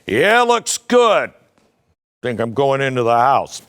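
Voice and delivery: mock rancher admiring his land; lazy gentleman rancher. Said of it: "walk around in a hat going".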